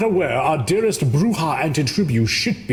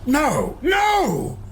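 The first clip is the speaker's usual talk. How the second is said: outraged; 12 semitones higher, slower than usual. Acted. Outraged denial in interrogation.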